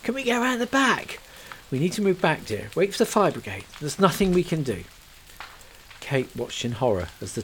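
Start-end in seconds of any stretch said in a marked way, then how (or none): 0.0-1.2 high, pleading question; grasping at hope; Kate voiced, desperate. Kate begs to try the back of the burning house. 1.7-4.8 gentle but firm; soothing a frantic woman; lightly voiced companion. Rose's companion gently holds Kate back from the fire.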